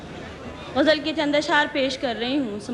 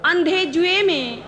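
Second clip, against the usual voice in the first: measured verse, repeats lifted higher; ghazal recitation; 3 semitones higher than usual. Acted. Poet presenting her ghazal to the gathering, measured recitation. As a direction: recite each line deliberately, pausing between phrases.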